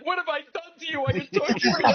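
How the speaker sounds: high, hammed-up lament; wife's mock despair. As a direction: playfully accuse him of torture, high. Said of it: "She's like".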